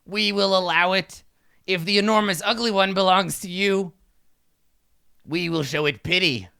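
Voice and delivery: a hint of a haughty kobold ruler; pompous kobold king, lightly voiced.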